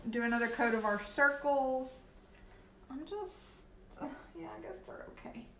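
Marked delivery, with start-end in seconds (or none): 2.9-5.5 whisper. Real, not acted. Muttering while checking paint.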